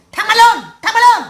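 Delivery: loud, high-pitched insult; acted abuse. Imitating a wife cursing her husband to his face, angrily.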